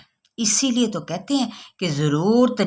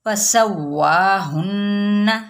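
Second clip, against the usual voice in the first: slow recitation; much slower than usual. Shown as a demonstration. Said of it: "models word slowly".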